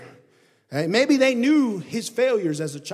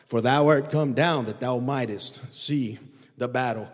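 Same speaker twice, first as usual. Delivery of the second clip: scripture reading; 4 semitones lower than usual. Acted. Reads Eliab's words from scripture.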